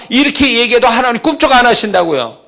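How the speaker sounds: fiery sermon voice; full-force preaching. Acted. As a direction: drive the point home to the congregation.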